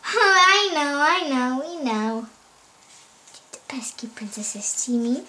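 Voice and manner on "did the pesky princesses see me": hushed, sneaky villain's question; furtive whisper. Pretend. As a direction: whisper it from hiding. Villain hiding from the princesses.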